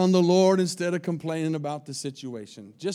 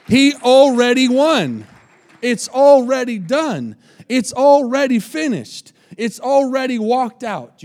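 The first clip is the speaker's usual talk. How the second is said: triumphant preaching; 5 semitones higher, 7 dB louder than usual. Acted. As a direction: proclaim the victory.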